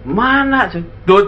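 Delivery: ordinary talk, nothing marked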